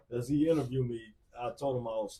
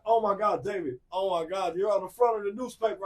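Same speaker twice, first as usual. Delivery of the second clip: higher, quick, thrilled announcement; excited sister; 7 semitones higher, 6 dB louder than usual. Retold or imitated. Sister telling David he's on the front page, excited and quick. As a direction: gush the news at him fast. Sister calls about newspaper.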